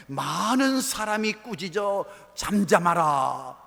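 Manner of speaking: loud, drawn-out scolding from the pulpit; loud rebuke. Retold or imitated. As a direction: stretch it out as a booming rebuke. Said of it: "막 꾸짖습니다".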